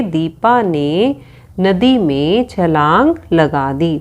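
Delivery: slow, each word set apart; slow dictation. Shown as a demonstration. Teacher dictating the reordered sentence to the children, slowly. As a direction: spell the sentence out slowly for writing. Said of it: "हम लिखेंगे".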